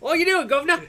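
high, laughing mock Cockney greeting; a possum tipping its hat in Cockney. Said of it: "A cockney possum".

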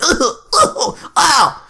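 high mock pained grunts, then "ow"; comic yelp of being hit; getting-hit noises. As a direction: imitate someone taking punches. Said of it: "sound like somebody's getting hit".

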